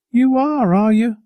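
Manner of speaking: high-pitched mock amazement; high, teasing uncle's retort; amused challenge. A narrator's reading. Uncle teases boy's partnership claim.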